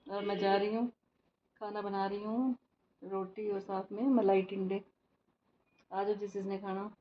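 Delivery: very soft, hushed vlog voice; hushed talk. Real, not acted. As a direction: keep it right down beside someone working. Filming beside Jatin's working office. (दबी हुई, बहुत धीमी आवाज़)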